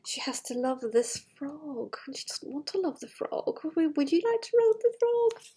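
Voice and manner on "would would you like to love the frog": high, loud teasing ask; playful high question. Pretend. Storyteller teasing the children, high and playful. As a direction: tease the listener about loving the frog.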